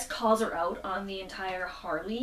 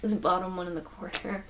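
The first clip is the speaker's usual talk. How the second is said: ordinary talk, nothing marked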